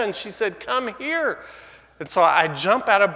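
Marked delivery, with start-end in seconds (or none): 0.6-1.4 urgent wife. Retold or imitated. Wife summoning husband.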